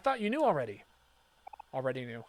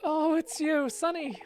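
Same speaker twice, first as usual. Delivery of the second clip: high, breathless relief at seeing Sonny; relieved character voice; 10 semitones higher, 4 dB louder, slower than usual. Acted. Greeting Sonny after fearing he was gone, high and relieved. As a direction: sound overjoyed he came, high and slow.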